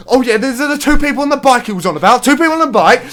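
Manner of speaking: loud, high hammed-up recognition; mock excitement. Friends excitedly spotting the bikers from directions. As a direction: sound thrilled at the clue. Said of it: "we went".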